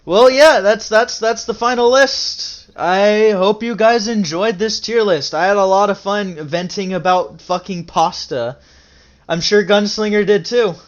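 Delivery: bright host outro; video outro. Acted. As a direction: wrap up the show for the viewers. Outro sign-off to viewers.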